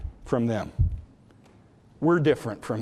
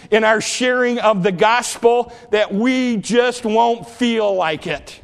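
fervent preaching; 8 semitones higher, 6 dB louder than usual. Acted. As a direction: preach it hard to the congregation. Preaching with full force.

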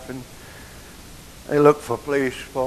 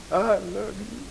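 higher, rising, tender repeat; heartfelt declaration; 8 semitones higher than usual. Retold or imitated. Son telling his mama he loves her, heartfelt. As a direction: say it higher, reaching out to her with love.

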